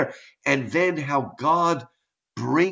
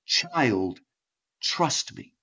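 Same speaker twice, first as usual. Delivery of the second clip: soft, hushed, tender assurance; gentle reassurance; 2 semitones lower, 5 dB quieter, much slower than usual. Acted. God answering our ravings, soft and reassuring. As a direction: say it quietly, as a comforting God. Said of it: "he will just say".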